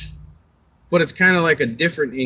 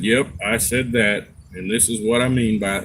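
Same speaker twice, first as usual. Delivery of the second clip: Morgan Freeman impression; 4 semitones lower than usual. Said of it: "he was talking like it was a poem".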